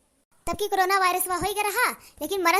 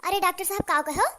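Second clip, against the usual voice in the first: ordinary delivery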